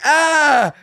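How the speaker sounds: high startled cry; mock scared squeak; frightened yelp. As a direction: yelp like you're cornered. Heckler backs down.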